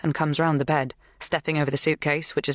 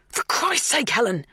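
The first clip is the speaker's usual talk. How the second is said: high, raised exasperated cry; exasperated outburst; 8 semitones higher than usual. A narrator's reading. Dan grabbing Helen's shoulders, exasperated. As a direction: snap at her to stop.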